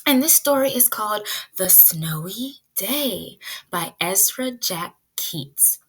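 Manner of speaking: normal talking voice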